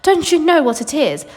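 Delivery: Wonka's challenge. A narrator's reading.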